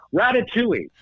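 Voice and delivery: high, eager blurted guess; excited shout. Retold or imitated. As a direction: blurt the answer out like a winning guess. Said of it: "Martell screams out".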